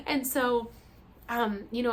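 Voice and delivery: ordinary talk, nothing marked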